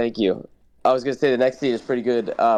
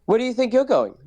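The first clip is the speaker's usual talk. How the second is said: high fussy robot voice, scolding his little partner; prissy droid voice; 10 semitones higher than usual. Retold or imitated. Droid scolding his runaway partner, high and fussy. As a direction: play the nervous butler droid. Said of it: "'Cause then he's like".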